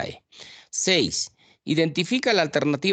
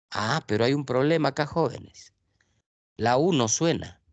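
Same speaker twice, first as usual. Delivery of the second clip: usual speaking voice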